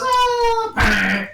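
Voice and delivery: high-pitched drawn-out "ah"; acted cry. Retelling a movie fall to friends, high mock cry. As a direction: cry out high, then drop.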